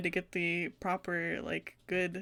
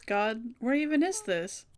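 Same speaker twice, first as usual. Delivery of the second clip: lightly voiced schoolgirl Mayu; 3 semitones higher than usual. Voices Mayu waking confused.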